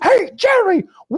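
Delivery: high, loud, put-on Kramer greeting; Kramer impression. Friend recounting Seinfeld, hamming up Kramer's entrance. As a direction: burst in like Kramer.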